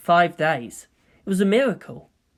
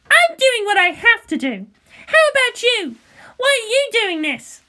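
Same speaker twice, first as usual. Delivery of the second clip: put-on high, loud, scornful girl; fiery teen pilot snapping; Asuka's voice; 4 dB louder than usual. Asuka scoffing at Shinji's thanks, high and loud.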